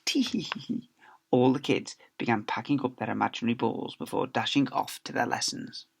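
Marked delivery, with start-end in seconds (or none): 0.0-0.9 soft, high breathy tee-hee giggle; stifled giggle. Acted. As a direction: giggle behind your hands. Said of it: "stifle their giggles".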